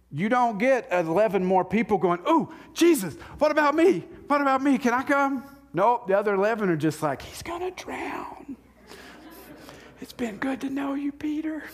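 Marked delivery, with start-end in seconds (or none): 2.3-5.4 hammed-up eager disciple pleading to come along; eager begging. 7.2-11.6 hushed mock whisper; hammed-up stage whisper, higher. Other disciples watching Peter.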